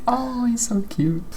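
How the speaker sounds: high, slow, soft coo; drawn-out adoring squeal, kept soft. Retold or imitated. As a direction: coo as if at a tiny animal. Cooing over a mouse, retold.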